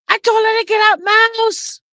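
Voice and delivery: high-pitched, indignant mother imitation. Mother telling her child she threw the lady out, indignant.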